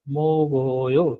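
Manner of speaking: मोगोयो drawn out slowly, syllable by syllable; slow teaching demo. Shown as a demonstration. Teacher slowly models मोगोयो for the class, drawn out. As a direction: stretch मोगोयो out slowly so students catch each syllable. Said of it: "दूसरी example इसने क्या दी?".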